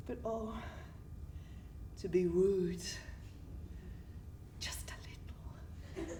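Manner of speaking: tiny, secretive whisper; whispered confession. Acted. Girl admitting she'd like to be wooed, whispered. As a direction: whisper it as a guilty admission.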